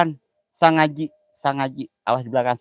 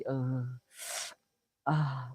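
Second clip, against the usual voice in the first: ordinary talk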